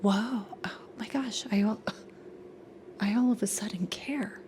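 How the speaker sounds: hushed, breathy fake concern; mock sympathy whisper. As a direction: whisper it like you suddenly care. Mocking sudden sympathy.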